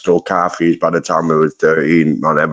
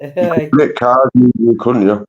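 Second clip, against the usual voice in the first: put-on voice; 5 semitones higher than usual. Repeats line in put-on voice.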